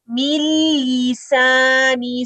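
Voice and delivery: मिन लिसानी with no nun sound, slow and chanted; slow recitation, no nun. Shown as a demonstration. Teacher showing students the merged nun. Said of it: "नून की आवाज नहीं आएगी".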